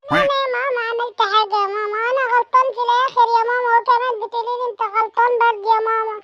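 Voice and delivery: put-on high, fast whiny boy protesting; child voice. Son complaining to mother that he's blamed, high fast child voice. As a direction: sulk like a wronged little kid.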